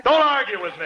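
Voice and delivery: high, sudden flare of temper; exasperated order. Acted. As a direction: cut off the argument, voice jumping up. Snaps at wife in quarrel.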